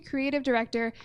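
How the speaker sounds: ordinary talk, nothing marked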